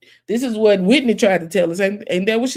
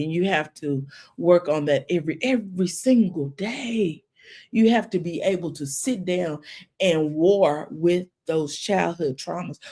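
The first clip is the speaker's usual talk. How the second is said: normal talking voice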